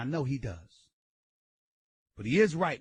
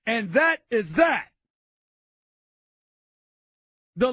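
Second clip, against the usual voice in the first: raised, higher voice hammering each point; loud rant; 11 semitones higher, 7 dB louder than usual. Real, not acted. Radio host blasting the Lakers, loud and heated. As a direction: lay into the team at full volume.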